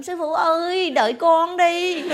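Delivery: high, drawn-out pleading call; mock calling after the master, stretching the words; gọi với theo. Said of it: "đi đâu cũng chạy theo". (tiếng gọi với theo kéo dài, giọng cao)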